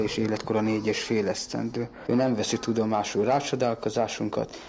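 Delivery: normal talking voice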